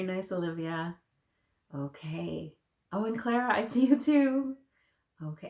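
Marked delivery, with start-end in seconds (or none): 2.9-4.6 delighted hello to a student; excited greeting. Real, not acted. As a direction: call out happily to Clara. Teacher spots child on camera.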